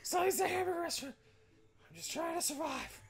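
squeaky strained lizard clerk. Acted. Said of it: "I was doing the lizard voice".

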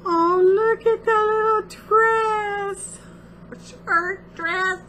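high, drawn-out cooing delight; high-pitched gushing "aww" voice; gushing over cuteness. Real, not acted. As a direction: coo slowly over something adorable. Gushing over a tiny gift.

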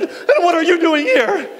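high, loud shocked demand; shrieked, mock-panicked question; alarmed challenge. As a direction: shout it like a startled man finding an intruder. Frightened man challenges intruder.

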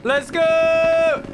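high, loud hype yell; excited shout. Real, not acted. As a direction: cheer it out at full volume.

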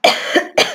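short throat-clearing cough; dry cough. Real, not acted. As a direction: cough from choking on a drink. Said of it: "Je suis désolée".

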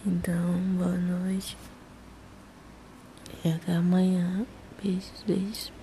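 sleepy whisper; nodding off. Real, not acted. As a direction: whisper it drowsily, half asleep. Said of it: "eu tô caindo de sono".

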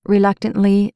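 ordinary delivery

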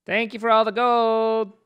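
high, loud mock thank-you; mock gratitude. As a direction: sing out a cheeky thanks. Teasing thanks for gold.

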